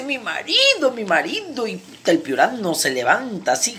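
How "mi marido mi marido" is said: high-pitched, urgent warning; alarmed wife. Acted. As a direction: blurt it in mock panic. Mujer alarmada por la puerta. (alarma fingida, con la voz aguda)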